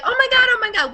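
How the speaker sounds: gushing team hysteria; excited shouting. Retold or imitated. Friend recalls the team's joyful reaction, shouting excitedly.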